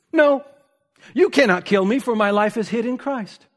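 high, loud retort in a retold dialogue; ringing acted defiance; defiant refusal. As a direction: throw the threat back boldly. Acted defiant reply to threat.

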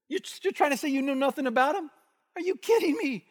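high-pitched, disbelieving accuser; mock incredulous. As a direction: challenge an obvious liar, voice shooting up. Acts accusers' disbelief at Peter.

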